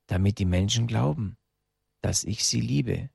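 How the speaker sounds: usual speaking voice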